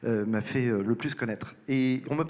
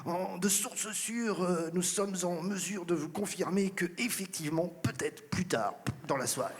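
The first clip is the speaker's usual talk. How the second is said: mock TV reporter; 6 semitones higher than usual. Parodying journalists outside Val-de-Grâce, mock solemn reporter voice. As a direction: ham up a live-news journalist outside the hospital. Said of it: "qui disaient".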